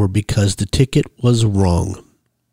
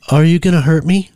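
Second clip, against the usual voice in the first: higher-pitched worried question; afraid; 7 semitones higher, 4 dB louder than usual. A narrator's reading. Woman asking her captor on the drive, worried. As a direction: ask anxiously, pitch lifted.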